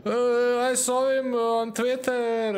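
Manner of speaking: a hint of a starstruck fan; mock fangirl gush. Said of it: "they're all like".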